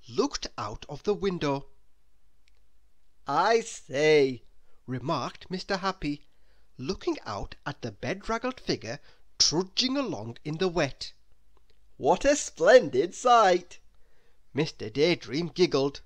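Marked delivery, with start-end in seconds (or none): 3.3-4.4 louder jolly gentleman; a cheery gentleman's remark; lightly voiced Mister Happy. 12.0-13.8 louder, slow, amused remark; jolly teasing gentleman. Acted. Mister Happy remarks.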